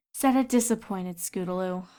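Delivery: ordinary delivery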